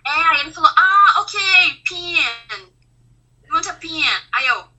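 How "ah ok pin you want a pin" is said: higher, louder "ah, ok", then "pin" the Kiwi way; light New Zealand "pin" for pen; a seatmate from New Zealand who finally understands; relieved Kiwi man. Retold or imitated.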